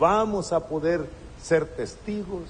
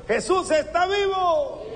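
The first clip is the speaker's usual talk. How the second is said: rousing call; 13 semitones higher than usual. Shown as a demonstration. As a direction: call it out for the congregation to answer. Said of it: "A ver".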